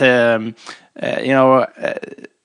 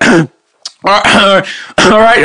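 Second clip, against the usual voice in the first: throat-clearing, higher-pitched entrance; coach walking in; 6 semitones higher, 3 dB louder, slower than usual. Retold or imitated. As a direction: clear the throat and announce yourself, imitating the coach. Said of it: "he comes in, like, sort of wet".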